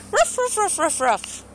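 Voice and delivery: high playful woof woof; dog bark imitation. Shown as a demonstration. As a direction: bark like a cartoon puppy.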